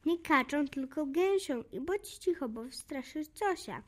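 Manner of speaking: ordinary delivery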